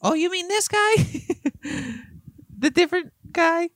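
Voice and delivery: high goofy puppet; squeaky silly puppet voice. Pretend. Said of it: "What's his character?".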